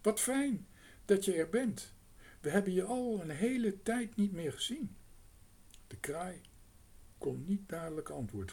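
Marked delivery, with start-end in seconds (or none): 0.0-5.0 higher and louder, glad to see a friend; delighted girl. A narrator's reading. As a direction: welcome the crow warmly, a girl's voice. Girl greets returning crow.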